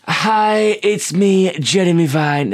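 hammed-up chirpy presenter greeting; Jeremy Vine impression. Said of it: "That's a really good impression".

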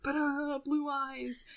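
mock dramatic card reveal, hushed; hammed-up fanfare for a trump card; imitating a villain flipping his best card. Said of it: "dramatically reveals".

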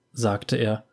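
ordinary delivery, nothing marked

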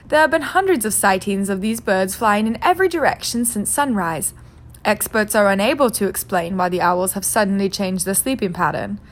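crisp TV newsreader voice; news anchor read. Acted. Newscaster reads the news.

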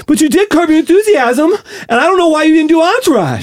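high, winded actor impression; Mark Wahlberg. Actor grumbling to Shaq, breathless Wahlberg voice. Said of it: "You're gonna go Wahlberg".

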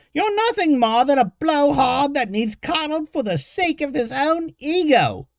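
put-on high, loud old woman's sneer; scornful old woman. A narrator's reading. Old woman mocking the barbarian, scornful. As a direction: belittle him in a cranky old voice.

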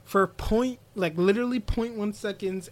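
high, soft, distressed apology; imitating a breakdown. Retelling Mirai apologizing for hesitating, breaking down. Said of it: "Mirai breaks down".

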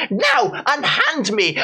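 high melodramatic stage cry; overplayed Victorian heroine; hammy stage actor. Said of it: "it starts out being like".

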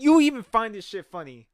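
usual speaking voice

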